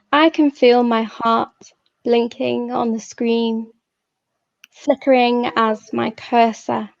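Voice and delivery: measured poetry reading with long pauses; poem reading. Acted. Poet reading her own hospital poem to a live audience, measured and unhurried.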